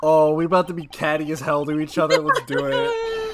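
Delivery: eager, hyped-up; gleeful. Real, not acted. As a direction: relish the fight ahead. Gleeful about coming argument.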